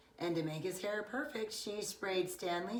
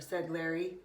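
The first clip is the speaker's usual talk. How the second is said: plain reading voice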